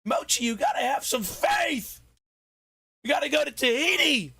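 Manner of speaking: high shouted preacher-like plea; rally Mochi with Dutch's faith speech; high yelled gang leader plea. Pretend.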